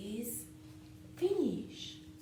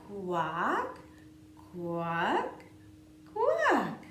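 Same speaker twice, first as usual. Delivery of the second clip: duck quacking; 7 dB louder, much slower than usual. Pretend. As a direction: quack like a playful duckling. Duck refrain.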